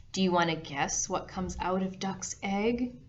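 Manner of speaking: plain reading voice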